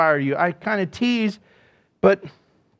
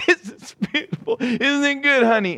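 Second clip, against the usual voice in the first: gushing, much higher, a hint of tears; mock teary gushing; 7 semitones higher than usual. Telling of weeping at the symphony to his wife, mock teary gush. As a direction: swoon over the music with mock tears. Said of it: "I'm there with tears going".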